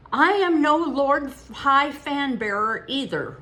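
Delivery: put-on high, loud, slow boyish retort; loud mock-haughty boy retorting. A narrator's reading. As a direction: fire it back with mock pride. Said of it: "retorted Hecate".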